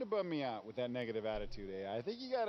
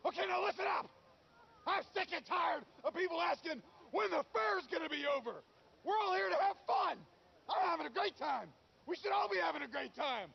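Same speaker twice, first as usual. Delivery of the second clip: high, strained furious shout; exasperated outburst at full pitch; angry yelling; 13 semitones higher than usual. Acted. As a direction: lose your temper and yell at everyone. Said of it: "Sorry I yelled at you".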